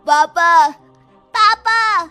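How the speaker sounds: loud cry for papa; calling out. Acted. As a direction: call out loudly for your father. Captive children call their fathers. (पापा को ज़ोर से पुकारता बच्चा)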